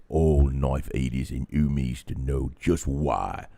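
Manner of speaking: loud, low orc war speech; rally the lads as a booming orc boss. Pretend. Orc about to launch a war rallies his lads, loud orc voice. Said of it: "could you put on your orc voice?".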